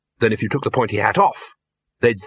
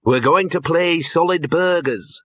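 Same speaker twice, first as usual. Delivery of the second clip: higher, slowed explanation; patient explaining; 6 semitones higher, slower than usual. A narrator's reading. Dean explaining game to Poons, slow and clear. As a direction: spell it out for a confused listener.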